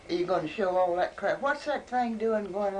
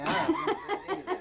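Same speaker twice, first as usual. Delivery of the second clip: laughing it out, much higher; 12 semitones higher than usual. Real, not acted. Grandma joking about being hatched, breaking into laughter. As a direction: giggle through the punchline.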